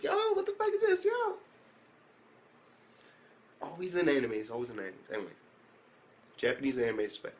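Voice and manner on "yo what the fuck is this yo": very high, squeaky exclamation; mock disbelief. Viewer reacting as the girl comes in, high mock disbelief.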